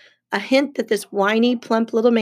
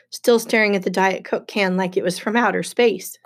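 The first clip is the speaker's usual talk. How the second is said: usual speaking voice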